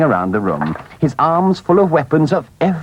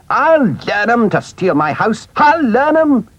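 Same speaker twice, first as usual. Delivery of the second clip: Toad bellows his threat, building to a yell; fierce cry; 10 semitones higher than usual. A narrator's reading. Toad rehearsing the attack on the weasels, building fierce cry. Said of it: "he cried".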